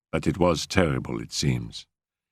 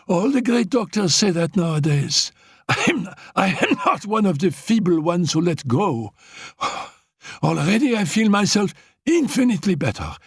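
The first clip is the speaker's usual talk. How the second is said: put-on high, strained voice of a sick young woman clinging to hope; feverish invalid girl; 12 semitones higher than usual. Dying Annette to Raoul, high feverish insistence. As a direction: play a frail girl insisting she'll live, pitched high.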